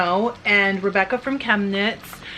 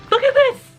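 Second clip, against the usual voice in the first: excited exclaim; 6 dB louder than usual. Retold or imitated. Showing husband the surprise card.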